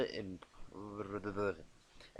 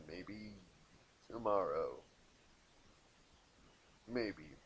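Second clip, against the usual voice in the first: trailing doubtful; 5 semitones lower, 9 dB quieter, slower than usual. Real, not acted. Teasing doubt about release.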